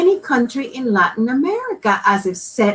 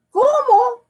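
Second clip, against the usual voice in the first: high startled question; shocked disbelief; father shocked by the news; exclaimed; 4 dB louder than usual. Acted. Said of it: "exclaimed Papa".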